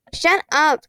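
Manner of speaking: high, sharp, angry retort; snarl. A narrator's reading. Constance snarls at Rainey, angry and high-pitched. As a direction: spit it out at him. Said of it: "Constance snarled".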